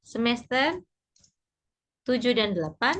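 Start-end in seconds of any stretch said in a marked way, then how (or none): none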